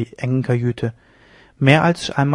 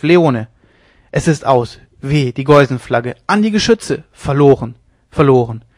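delirious captain; 3 semitones higher, 5 dB louder than usual. A narrator's reading. Sick captain raving of a sea battle to Leone, delirious shouting. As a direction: shout frantic orders as the ship is lost.

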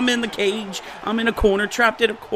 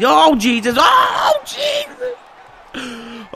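panicked; 7 semitones higher, much slower than usual. Real, not acted.

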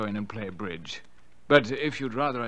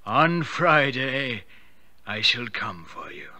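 slow, hollow voice from beyond the grave; drawn-out ghostly warning from the other world; dead husband's voice summoning his wife; sepulchral ghost voice; 4 semitones higher, slower than usual. Acted. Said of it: "spoke from the other world in the same sepulchral tones".

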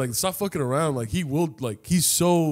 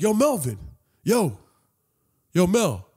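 imitated hail, pitched up, with pauses; high, punchy shout-out without full volume; calling out; 5 semitones higher, slower than usual. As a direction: call someone over from afar. Rival calls out to him.

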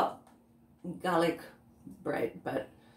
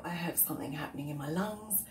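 whisper; 5 semitones lower, 8 dB quieter, faster than usual. Real, not acted. Talking about lung trouble.

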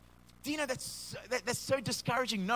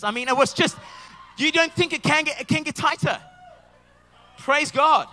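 shouting louder, higher, fired up; excited preaching shout; 5 semitones higher, 9 dB louder than usual. Real, not acted. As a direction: preach it loud, carried away by the story.

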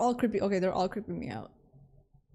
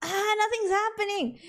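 high-pitched fretful whine; drawn high cry of nerves; anxious wail; 11 semitones higher than usual. Real, not acted. Tense wait, nothing happens in game.

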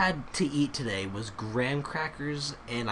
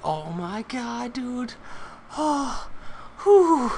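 very high, giddy disbelief; overwhelmed excitement; 13 semitones higher, 5 dB louder, slower than usual. Real, not acted. Gamer thrilled at attack level two, overwhelmed excitement. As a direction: squeal it, then let out a whooped breath.

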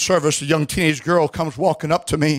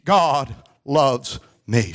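solemn call-and-repeat; 2 semitones lower, much slower than usual. Shown as a demonstration. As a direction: leave space after each word for the congregation. Leads congregation in repeating words.

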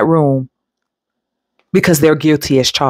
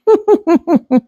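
burst of laughter; 13 semitones higher than usual. Real, not acted. Laughing at her own wish to beat up animal abusers.

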